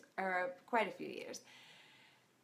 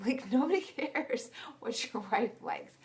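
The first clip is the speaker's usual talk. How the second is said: usual speaking voice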